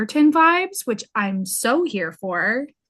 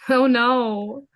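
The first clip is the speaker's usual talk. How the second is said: gloating fake sympathy; 3 semitones higher, 4 dB louder than usual. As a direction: pretend to pity, clearly delighted. Ironic reply to dream scenario.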